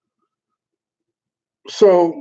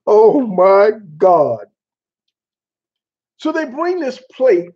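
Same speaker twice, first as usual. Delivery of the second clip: usual speaking voice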